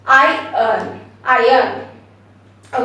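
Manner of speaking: I earn said slowly as eye-urn; I earn, two slow parts, r silent; silent r iron. Shown as a demonstration. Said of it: "It's a silent letter".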